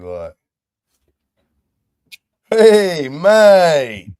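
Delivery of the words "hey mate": loud, high friendly Hey, mate with long falling mate; positive tone. Shown as a demonstration. Showing the friendly way to say mate, bright loud Hey, mate. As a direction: greet an old friend warmly. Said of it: "how would you say it positively".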